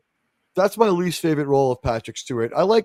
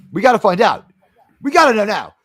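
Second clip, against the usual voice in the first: louder, higher insistent demand; mock urgency; 5 semitones higher, 5 dB louder than usual. Host demanding someone check the Super Bowl site, mock urgency.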